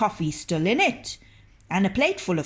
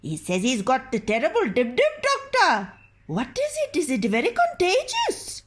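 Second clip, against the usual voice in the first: high, worried wife voice; a frightened wife pleading with the doctor; anxious wife; 7 semitones higher, 4 dB louder than usual. Acted. The wife asks the doctor about the illness, high and worried.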